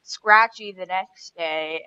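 ordinary talk

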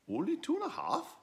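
very high incredulous squeak; indignant question. Acted. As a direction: squeak it in disbelief. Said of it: "said Pig Squeak".

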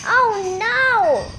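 a gorilla sees her gift sink; groaning oh no. A narrator's reading. Gorilla dismayed at her lost gift, groaning. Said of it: "Gertie groaned".

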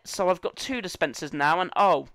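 ordinary talk

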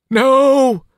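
high, loud terrified scream; piercing shriek of terror. Acted. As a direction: scream it as the light engulfs you. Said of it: "the girl screamed".

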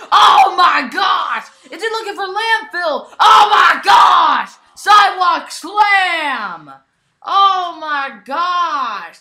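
loud, high, slowed move calls; wild ringside excitement; frenzied shouting. Real, not acted. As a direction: scream each call like a live announcer. Sidewalk slam from wall.

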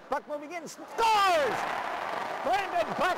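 high shout of the scorer's name; goal yell. Real, not acted. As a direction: explode on scores. Goal call.

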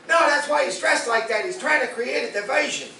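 high-pitched, hammed-up security panic; alarmed TSA agents. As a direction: mimic overexcited airport agents raising the alarm. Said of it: "the T S A people are like".

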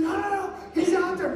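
high-pitched, insistent protest; acted insistence. Rhoda insisting to the doubters, high mock protest. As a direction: insist against people who don't believe you. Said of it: "but she kept insisting".

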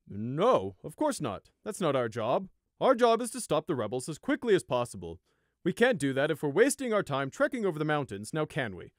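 dismissive guard. Acted. Border guard brushing off villagers: condescending. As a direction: brush them off like it's obvious.

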